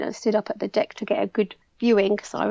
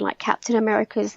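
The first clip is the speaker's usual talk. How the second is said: ordinary talk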